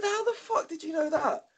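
high-pitched astonished question; amazed squeal. Retold or imitated. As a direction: ask it in stunned disbelief. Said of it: "I said".

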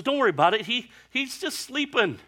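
high, loud, airy reassurance; Jesus brushing off the disciples, high and airy; breezy Jesus. Retold or imitated. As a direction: wave off their worry lightly. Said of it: "Jesus says".

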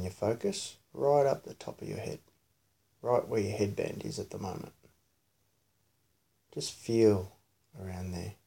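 soft, quiet meditation voice; hushed, soothing body-scan guidance; guided meditation. Real, not acted. Leads child's guided meditation.

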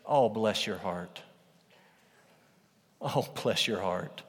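slow, soft mock pity; softly condescending sympathy; patronizing pity. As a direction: pat them on the head with your voice. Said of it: "sort of patronizing phrase".

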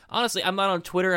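ordinary talk, nothing marked

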